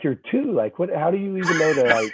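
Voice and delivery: high-pitched, baffled dad retelling; incredulous. Retold or imitated. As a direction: pitch it up in amused disbelief. Dad's retold disbelief at his two-year-old.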